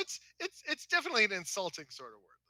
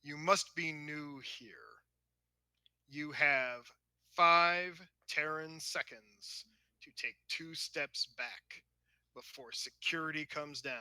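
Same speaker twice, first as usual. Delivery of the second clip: slow, measured ultimatum, dropping softer; stern officer; 5 semitones lower, 4 dB quieter, slower than usual. Acted. Officer warning Klingons before security comes, stern ultimatum. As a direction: count out the threat deliberately.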